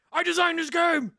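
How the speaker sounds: high, loud, hammed-up cry; over-the-top shouted proclamation; mock frantic shout. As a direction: yell it like a man back from decades trapped. Acting out crazed bearded Jumanji designer.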